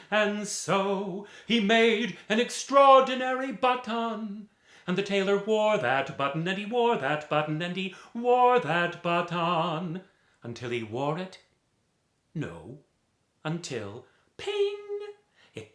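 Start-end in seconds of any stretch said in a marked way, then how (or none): 0.1-10.0 big build. A narrator's reading. Storyteller builds the button refrain, big and loud. 14.4-14.8 very high squeaky ping; popping ping. A narrator's reading. Storyteller imitates the button popping, very high.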